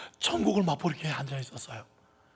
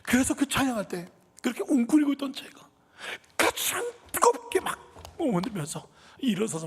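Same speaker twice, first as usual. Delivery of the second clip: choked with emotion; 10 semitones higher, 4 dB louder than usual. Real, not acted. Overwhelmed, near tears.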